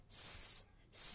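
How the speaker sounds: "S" as a long unvoiced hiss. Shown as a demonstration. Teacher isolates the consonant for students, hissed S. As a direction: let "S" hiss, as at the start of sister. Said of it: "Ang S sound like sister".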